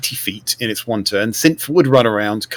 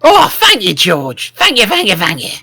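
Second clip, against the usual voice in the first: high grateful goblin squeak; 7 semitones higher than usual. Acted. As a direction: gush thanks in a goblin voice. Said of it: "he says in his goblin voice".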